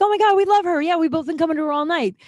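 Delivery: high, excited fan gush. Retold or imitated. Two bar customers gushing about their bartender, excited.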